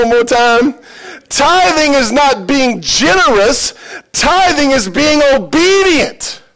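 loud, high preaching with a laughing start; full-force preaching. Acted. Pastor urging the congregation to tithe, loud preaching. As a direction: preach it out to the whole church, building and emphatic.

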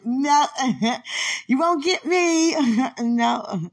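high, loud, laughing no; laughing denial. Real, not acted. Teasing Quenisha back, laughing and high. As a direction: laugh it off and refuse to be caught.

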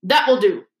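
sharp, curt rebuke. Acted. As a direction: cut the pupils off sharply. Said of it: "he said sharply".

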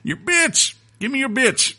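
put-on very high crazy old prospector voice; squeaky mad gold miner. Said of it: "I'm playing as a crazy prospector".

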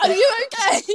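high, squeaky question through laughter; laughing. Real, not acted. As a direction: ask while still cracking up. Both cracking up after the impression.

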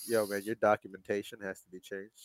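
very soft official notice; quiet bureaucrat reading out a change; deadpan clerk. Acted. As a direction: drop to a hushed, deadpan announcement. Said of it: "As the person at the fucking".

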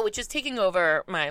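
normal talking voice

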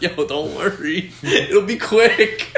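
high, slow coaxing broken by laughter; mock coaxing. Mimicking his friend coaxing a girl, high and laughing. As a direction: imitate a friend's drawn-out reassurance, cracking up.

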